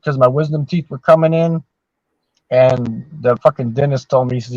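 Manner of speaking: normal talking voice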